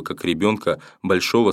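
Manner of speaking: plain reading voice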